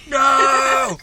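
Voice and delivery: loud, high cry of fake alarm; mock shout. Driver yelling in fake panic at the snowy road. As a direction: yell no like you're panicking.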